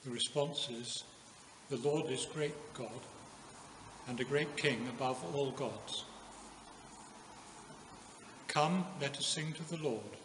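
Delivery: normal talking voice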